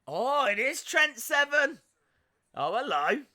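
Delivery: loud, high cry of recognition; excited surprise. Real, not acted. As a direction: react live as a wrestler turns up. Excited reaction to wrestler's arrival.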